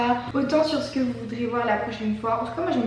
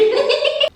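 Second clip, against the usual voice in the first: rire aigu; 8 dB louder than usual. Real, not acted. A tout fini le verre.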